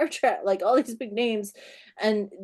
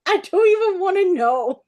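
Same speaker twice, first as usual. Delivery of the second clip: high, loud, mock-horrified; appalled refusal; 7 semitones higher, 5 dB louder than usual. Author sees horse-mask getup walk past, appalled. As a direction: wave it away, scandalized but amused.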